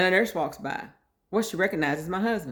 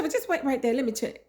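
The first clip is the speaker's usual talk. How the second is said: lightly raised mock nurse voice; voicing the desk lady; 8 semitones higher than usual. Intake lady telling her to wait, pitched up.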